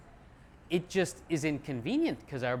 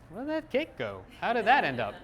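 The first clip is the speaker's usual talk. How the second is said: mock surprise; 5 semitones higher than usual. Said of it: "I look down and I'm like".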